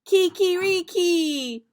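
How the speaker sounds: high, loud, slow kikiriki crow; loud rooster cry; Spanish rooster crow. Shown as a demonstration.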